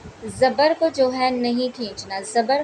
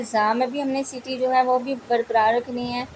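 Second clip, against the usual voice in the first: ordinary talk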